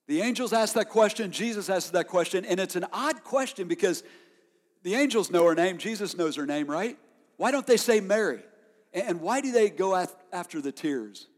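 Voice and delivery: preacher raising the question; preaching. Acted. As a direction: press the congregation with the puzzle. Pressing rhetorical questions.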